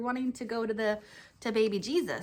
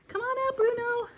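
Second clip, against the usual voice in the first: much higher and louder call for the puppy; 13 semitones higher, 4 dB louder than usual. Acted. Pastor calling Bruno out again for the kids, high eager call. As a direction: sing it out to coax Bruno from his hiding place.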